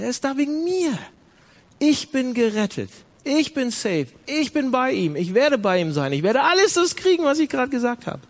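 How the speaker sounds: joyful preaching climax. Acted. (freudig steigernder Predigthöhepunkt)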